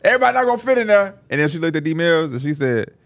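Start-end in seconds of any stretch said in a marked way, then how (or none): 0.0-1.1 stylist's announcement. Retold or imitated. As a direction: call it out high as the stylist. Retelling stylist's loud announcement.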